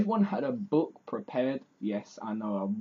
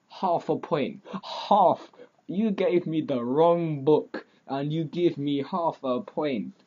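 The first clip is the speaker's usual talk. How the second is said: loud, raised-pitch mock outrage; indignant outburst; 4 semitones higher, 6 dB louder, slower than usual. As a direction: protest it slowly and emphatically, word by word. Rants over being given half a point.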